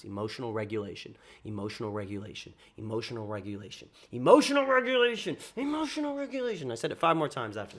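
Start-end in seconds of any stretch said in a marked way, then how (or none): 0.0-3.9 soft repeated mantra under his breath; muttered to himself. Retold or imitated. Telling himself to calm down after the rejection, quiet muttered mantra. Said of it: "I say that in my mind". 4.1-6.7 mantra jumping higher, half-mocking; mantra pitched up. Hyping himself after the rejection, mock high-pitched mantra. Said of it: "I say that in my mind ten times".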